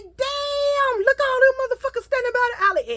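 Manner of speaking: very high astonished shout; shocked shout. Retold or imitated. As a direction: yell it at the crowd in disbelief. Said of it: "He said".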